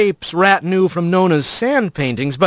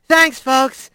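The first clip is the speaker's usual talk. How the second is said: higher, friendly crowd address; otter speaker voice; 8 semitones higher than usual. A narrator's reading. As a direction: greet the crowd warmly.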